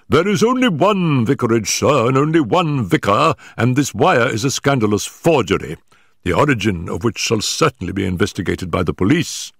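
the vicar showing his callers the door; lightly voiced pompous vicar, scandalised; indignant vicar. Vicar indignantly dismisses them.

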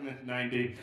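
low, offhand shrug of a line; nonchalant. Acted. Comic imitating a guest unfazed by turning ninety, nonchalant. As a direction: toss it off as no big deal.

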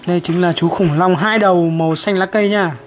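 normal talking voice

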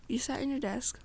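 ordinary delivery

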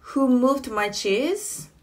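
ordinary talk, nothing marked

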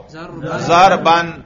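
very slow, careful ज़रबन with -an ending; nasb ending demo. Shown as a demonstration. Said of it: "करके दिखाओ".

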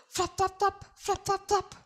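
high, soft flap sounds; flapping cry. A narrator's reading. Quokka pretending to fly, high flops. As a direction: chant the flops like flapping wings. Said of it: "cried Quokka".

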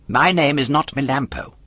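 a hint of an indignant wooden boy; puppet voice. A narrator's reading. Puppet corrects the polecat, a touch indignant.

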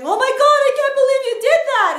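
high, loud, fast gasping gossip; mock shocked gossip. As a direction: squeal it like a shocked busybody. Gossiping people voiced, shocked.

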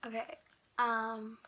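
talking around gum; slightly muffled by chewing; chewing gum while talking. Real, not acted. Seen 0: Blindfold on; given item at -9, jaw and lip chewing shapes.

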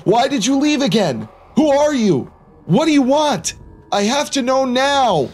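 high-pitched, demanding acted shout; angry shout. A narrator's reading. Narrator running to the shadowy figure, angry shouting. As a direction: fire off the questions in heated desperation. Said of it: "I ran to him and shouted".

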